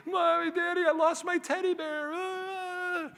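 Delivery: put-on high, slow, whiny little girl voice; crying child. Four-year-old daughter crying to her parents, high whiny wail.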